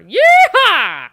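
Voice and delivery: high, loud cowboy whoop; real triumphant yeehaw. Real, not acted. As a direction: holler like a rodeo rider. Cowboy victory cry.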